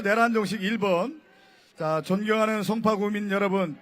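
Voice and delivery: usual speaking voice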